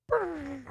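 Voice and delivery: high rolled bird trill; bird noise. Shown as a demonstration. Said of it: "I can't do that noise".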